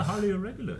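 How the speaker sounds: high, put-on Palpatine senator voice; pompous senator oratory. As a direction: mimic a scheming old senator. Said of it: "Like a Senator Palpatine voice".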